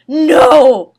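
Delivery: loud, high outcry; a sudden loud protest; shocked cry. Acted. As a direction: cry out in alarm.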